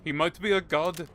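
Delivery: a deliberately bad Indian accent, hammed up. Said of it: "I'm doing a bad Indian accent".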